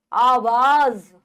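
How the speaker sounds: आवाज drawn out with a buzzing z, 'aavaaaz'; slow 'aa-vaaz' for आवाज; z with nukta. Shown as a demonstration. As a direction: stretch आवाज slowly to a clear z. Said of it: "ज के नीचे नुक्ता लगा हुआ है".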